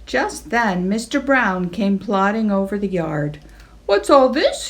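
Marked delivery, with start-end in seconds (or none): 3.9-4.7 whinny. Acted. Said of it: "he whinnied".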